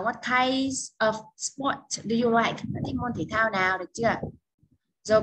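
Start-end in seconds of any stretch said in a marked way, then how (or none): 0.0-2.6 slow model question. Shown as a demonstration.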